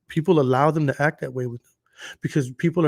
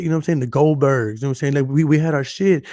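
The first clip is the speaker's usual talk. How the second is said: ordinary talk, nothing marked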